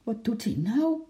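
plain reading voice

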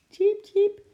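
high, loud little cheeps; piercingly high animal peep; squirrel chirp. A narrator's reading. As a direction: chirp brightly like a tiny squirrel.

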